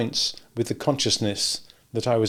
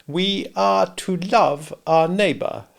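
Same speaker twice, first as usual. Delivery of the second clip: Sarah Pocket; 7 semitones higher, slower than usual. A narrator's reading.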